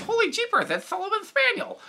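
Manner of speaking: high, excited cartoon dog voice; Sullivan voice. Shown as a demonstration. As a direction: gush like an excitable spaniel. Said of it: "the Sullivan voice".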